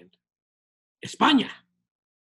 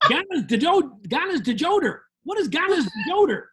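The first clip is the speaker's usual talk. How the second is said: high-pitched mock American accent mangling Spanish; gringo Spanish; 6 semitones higher than usual.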